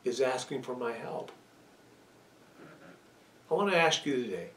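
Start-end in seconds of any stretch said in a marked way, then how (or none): 0.0-1.3 patient father. Retold or imitated. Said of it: "He goes".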